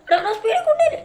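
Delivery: high, laughing alarmed question; half-laughing panic; startled. Acted. Anna alarmed as Arjun speeds, high and laughing.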